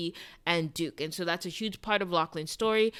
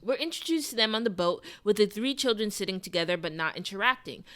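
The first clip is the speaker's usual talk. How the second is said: ordinary delivery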